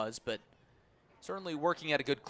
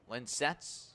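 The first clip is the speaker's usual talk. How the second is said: normal talking voice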